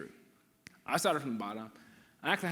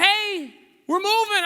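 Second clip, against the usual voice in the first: much higher and louder, bursting with news; excited announcement; 13 dB louder, slower than usual. Retold or imitated. Friend announcing the family is moving, high excited burst. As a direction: announce the move like big news. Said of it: "he's like".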